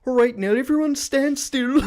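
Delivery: high, loud mimicked call; mock announcement. Retelling how the music stops, mimicked high loud call. Mimicking a vocal line in a song.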